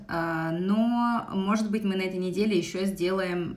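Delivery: usual speaking voice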